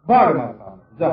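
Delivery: retold God barking get away; gruff dismissal. God sending away the bored devotee, in a mock gruff shout.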